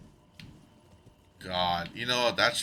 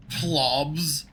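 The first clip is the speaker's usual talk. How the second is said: incredulous; 6 semitones higher, 4 dB louder than usual. Real, not acted. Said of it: "I have plobs".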